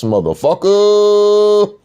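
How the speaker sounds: stretched very slowly and high; mock taunting finale; drawn-out high curse. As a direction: draw the word out long and high. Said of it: "I said".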